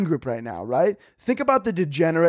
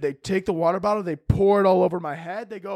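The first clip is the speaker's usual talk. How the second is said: normal talking voice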